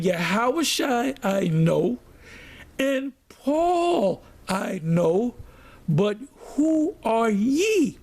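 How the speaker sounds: very slow, drawn-out taunt, rising in pitch; demon's attitude. Acted. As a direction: stretch each phrase like a scornful demon. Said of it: "That was the attitude that these demons took".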